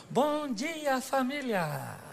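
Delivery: soft, drawn-out greeting that starts high and falls; mock-sweet stretched greeting; convert's cheerful greeting. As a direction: act out a gentle, singsong hello to the family. Voices the convert greeting family.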